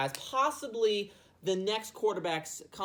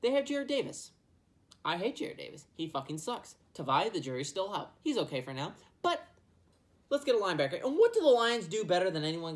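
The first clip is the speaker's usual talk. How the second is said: ordinary talk, nothing marked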